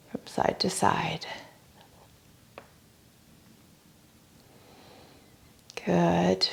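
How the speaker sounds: soft yoga voice fading toward a near whisper; guided breathing. Real, not acted. Yoga teacher guiding breath and gentle swaying, hushed guided breathing. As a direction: let the breathing cue trail into hushed quiet.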